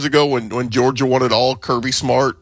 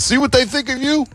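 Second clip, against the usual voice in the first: mock coach pep talk; 12 semitones higher than usual. Coach rallying players, mocked.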